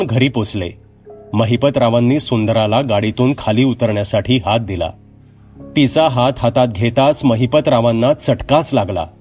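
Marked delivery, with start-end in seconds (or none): none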